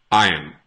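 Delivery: iron as EYE-un, no R, falling; repeat after me. Shown as a demonstration. Teacher asking students to repeat iron. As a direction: say iron slowly for repetition. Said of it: "Repeat after me".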